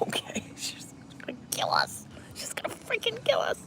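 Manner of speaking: whispered mock dread, climbing high; panicked whisper. Fearing mom will find out, whispered mock panic. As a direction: hiss it like you're already caught. Fearful aside about getting caught.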